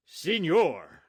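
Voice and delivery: high, loud outcry at the soldier; loud, indignant shout of Señor; friar cries out. A narrator's reading. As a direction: call out in indignation. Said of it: "Fray Felipe cried".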